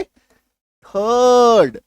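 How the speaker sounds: थर्ड extremely drawn out, "thuuuhd"; silent R. Shown as a demonstration. Teacher answering own question, थर्ड very long with silent R. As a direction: hold the vowel of थर्ड with no R. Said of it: "क्या बोलेंगे".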